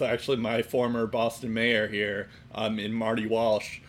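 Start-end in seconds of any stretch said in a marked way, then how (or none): none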